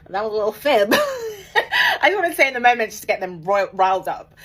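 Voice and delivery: loud, high laughing confession; loud laughter admitting a little fib. Real, not acted. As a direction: giggle through the admission.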